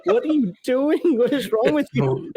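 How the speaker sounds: high, incredulous mock scold; wife scolding. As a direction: imitate an exasperated wife. Wife scolding his girl Japanese.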